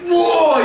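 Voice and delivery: high drawn-out "whoa"; acted whoa. Victor grabbing eighteen men, high acted whoa. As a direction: call out a high-pitched whoa.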